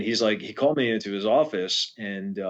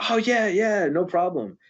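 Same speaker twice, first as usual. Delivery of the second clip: breezy higher Jay; 9 semitones higher than usual. Retold or imitated. Jay answering about packed drums.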